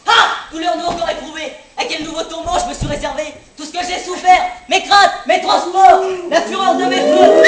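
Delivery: overacted tragic verse, building to a shout; hammy stage actor. Shown as a demonstration. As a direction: ham up Racine like a terrible tragedian. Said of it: "déclamer d'une manière très fausse".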